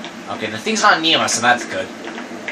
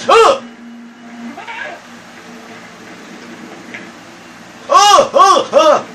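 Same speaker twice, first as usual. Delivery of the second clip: panicked gasps; much slower than usual. Real, not acted. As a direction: gasp out in sheer panic. Scared by the monster.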